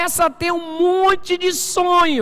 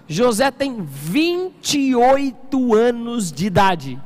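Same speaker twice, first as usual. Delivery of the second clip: slow, weighty, every word drawn out; slow emphasis; much slower than usual. Shown as a demonstration. Pastor stresses José's age to the church, slow and heavy. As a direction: spell out the age slowly for the congregation. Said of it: "Quantos anos?".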